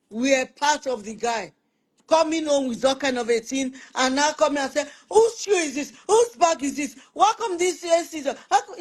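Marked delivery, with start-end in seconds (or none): 5.1-8.8 high, half-laughing complaint; nagging husband. Retold or imitated. Husband coming home and nagging his family, high and fussy. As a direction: fuss at every little thing, pitched up.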